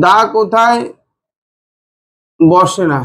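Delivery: broken in two with a long pause; dictation pace. Shown as a demonstration. Teacher has students write the heading, paused dictation. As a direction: dictate it in chunks, leaving time to write. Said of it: "চলো এবার লিখো".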